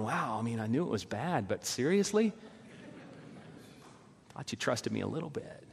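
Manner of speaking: soft, deflated aside; lightly voiced hurt God; mock disappointed God. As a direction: pretend letdown, quietly. Voicing God as let down.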